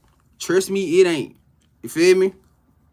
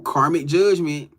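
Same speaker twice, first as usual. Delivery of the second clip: ordinary talk, nothing marked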